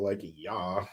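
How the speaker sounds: exaggerated Valley Girl whine. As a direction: do a mock Valley Girl accent. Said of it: "Please do a Valley Girl".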